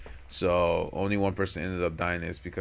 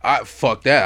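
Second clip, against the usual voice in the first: ordinary talk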